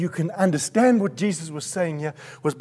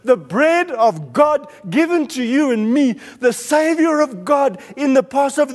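impassioned, louder preacher's delivery; full-force preaching; 4 semitones higher, 3 dB louder than usual. Real, not acted. Preacher proclaiming Jesus as bread of God, full-force preaching. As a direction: proclaim it emphatically to the congregation.

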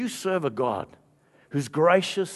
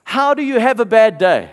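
loud, high, disbelieving question; incredulous challenge; 8 semitones higher, 10 dB louder than usual. Retold or imitated. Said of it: "He says to him".

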